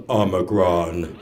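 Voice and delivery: low, slow, drawn-out ominous pronouncement; really deep voice. Retold or imitated. Imitating the elder naming the variant, deep slow voice. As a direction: drop it down deep like a Transformer. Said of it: "Her voice had changed".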